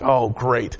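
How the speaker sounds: low, deflated sarcasm; dry dismay. Retold or imitated. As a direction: sink into a resigned aside. Dismayed reaction to ticket letter.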